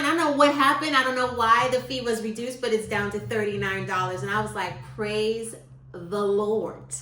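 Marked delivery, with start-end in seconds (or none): 5.0-6.9 drawn-out, slow praise the Lord. Retold or imitated. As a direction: stretch each word in relief. Relief at reduced fee.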